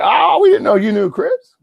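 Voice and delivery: loud, high-pitched mock surprise; surprised exclamation. Barbecue guests find out they know each other, loudly surprised.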